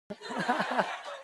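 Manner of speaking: a short, high, breathy laugh; हल्की ऊँची हँसी. Real, not acted. Laughing at being stopped. (ऊँची आवाज़ में छोटी सी हँसी)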